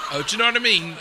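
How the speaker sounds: loud, high, run-together Cockney; East End Cockney. Shown as a demonstration. Guest cracking the phonetic phrase, loud Cockney accent. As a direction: slur it together like an excited East Ender. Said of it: "you should sound like an East Ender".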